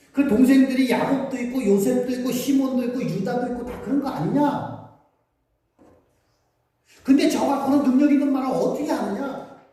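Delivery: high, loud mocking disbelief; scoffing townsfolk. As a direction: sneer at the carpenter's son. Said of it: "예수님의 고향 사람들은". (높고 크게 비웃듯이)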